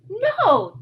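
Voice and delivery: high, loud denial; indignant high no; insisting. Acted. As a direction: deny it hard. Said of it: "Jared insisted".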